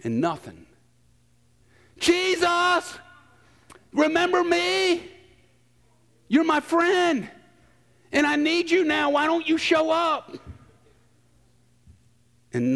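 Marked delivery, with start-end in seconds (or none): 2.0-10.4 desperate plea. Retold or imitated. Pleading prayer to absent friend.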